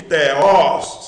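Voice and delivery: TEOS loud, weight on OS with an open o; stress on OS. Shown as a demonstration. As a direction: hit the open OS at the end of TEOS. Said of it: "sílaba forte, os, o aberto".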